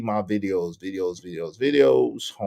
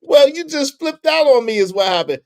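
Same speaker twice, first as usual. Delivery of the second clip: retold annoyed retort; 11 semitones higher, 5 dB louder than usual. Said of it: "Anger Man was like".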